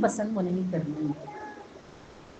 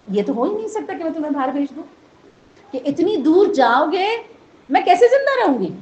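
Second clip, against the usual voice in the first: lightly voiced anxious mother, rising higher and louder; a mother pleading not to be left alone; worried mother; 4 semitones higher, 5 dB louder than usual.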